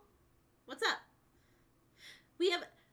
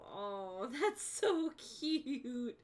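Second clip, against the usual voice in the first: laughing, charmed; 6 semitones lower, much slower than usual. Real, not acted. Hearing the kitten's name.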